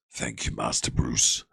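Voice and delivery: low, slow, rasping Batman growl; Alfred doing Batman. Acted. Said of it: "it's like Alfred impersonating Batman".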